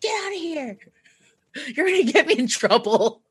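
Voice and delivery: mock-frantic high telling-off; urgent hushed scolding. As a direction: shoo the dog out in a panic, keeping it down. Retold urgent scolding of dog.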